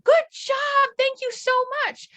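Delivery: very high, excited thanks; gushing dog praise. Shown as a demonstration. Owner rewards adult dog after a correction, high gushing praise.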